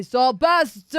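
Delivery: high, loud in-character yell; calling out. Acted. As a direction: holler the name across a distance. Game master voices Filbert calling out.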